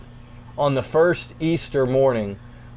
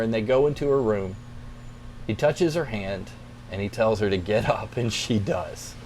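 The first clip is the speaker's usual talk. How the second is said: ordinary talk, nothing marked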